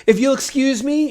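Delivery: put-on high, polite old voice, rising at the end; dutiful mummy. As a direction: excuse himself in a prim high voice. Mummy leaves the party.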